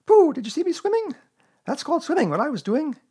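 high, excited squeaky chatter; Roo. A narrator's reading. Little one boasting to each friend, squeaking excitedly. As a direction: squeak proudly at everyone in turn. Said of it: "squeaked Roo excitedly".

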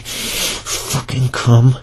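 very slow stretched demand; slow dragged command; drawn out. Acted. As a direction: stretch every word out slowly.

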